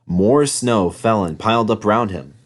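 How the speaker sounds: ordinary delivery, nothing marked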